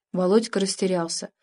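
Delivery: usual speaking voice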